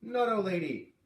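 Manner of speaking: put-on high, loud boy's outburst; a boy spitting out his verdict; upset little boy.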